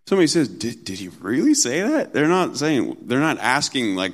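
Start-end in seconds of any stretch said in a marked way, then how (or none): 0.5-2.1 a scoffing rise on that; mocking. Someone ridiculing what God said, mocking tone. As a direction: make fun of the command. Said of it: "They're, they're making fun of it".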